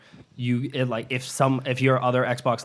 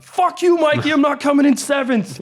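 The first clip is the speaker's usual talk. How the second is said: furious shout, much higher and louder; angry yell; 9 dB louder than usual. Retold or imitated. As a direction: scream it at your rival. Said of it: "and I'm like".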